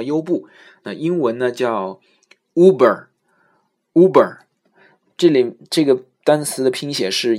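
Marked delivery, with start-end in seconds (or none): 2.5-4.4 slow Uber opening with a clear U sound. Shown as a demonstration. Teacher modelling Uber with a long U. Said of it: "我们发U的音".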